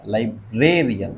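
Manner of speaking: "Librarian" slowly, with ब्रे stretched and stressed; broken-down stress demo. Shown as a demonstration. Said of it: "rayon के पहले वाला कौन सा है?". ("लाइब्रेरियन" धीरे, ब्रे को खींचकर)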